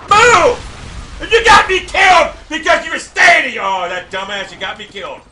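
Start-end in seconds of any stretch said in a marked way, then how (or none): 0.1-3.6 high, loud real yell at a teammate; furious shout. Real, not acted. Gamer yelling at a teammate who got him killed, furious shout. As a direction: blast him for standing still.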